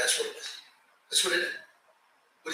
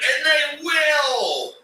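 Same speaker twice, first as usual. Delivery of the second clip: slow shouted they will; emphatic shout; 6 semitones higher, much slower than usual. Real, not acted. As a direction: hammer it home slowly. Ranting about keeping quiet.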